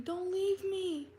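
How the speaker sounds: high, slow, wailing plea; mimicking an ex's high drawn-out wail of don't leave me; mock pleading scream. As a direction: imitate a clingy man begging, drawn out high. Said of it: "Like, screaming, like, very loudly".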